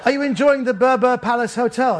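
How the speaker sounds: high, loud forced politeness; chatting brightly to hide the awkwardness; making polite chatter while being held; nervous small talk. Retold or imitated. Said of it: "I said".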